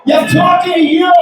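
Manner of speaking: very high, confrontational shout; mock road-rage yell. Driver confronting the comedian in traffic, mock angry shout. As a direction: yell a challenge across the lanes. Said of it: "the guy next to me yells at me".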